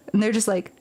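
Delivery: usual speaking voice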